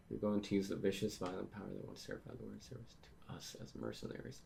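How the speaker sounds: fast, trailing into a very soft mumble; quick reread, fading to near-inaudible; rushed mumbled read. Real, not acted. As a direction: skim it quickly under your breath. Rushes through rereading the last panel.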